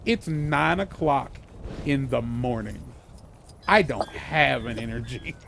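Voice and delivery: slow, low, unimpressed comeback; imitating his own morning grumpiness; retold deadpan retort. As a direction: drag it out low and dry. Retells his weary morning retort.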